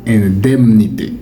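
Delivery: Indemnité with "dème" in the middle; correct pronunciation. Shown as a demonstration. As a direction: model Indemnité as "in-dème-nité". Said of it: "Le mot se lit indemnité". (Indemnité prononcé « in-dème-nité »)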